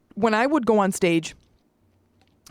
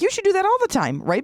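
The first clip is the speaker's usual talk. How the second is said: teasing wife; 13 semitones higher than usual. Retold or imitated.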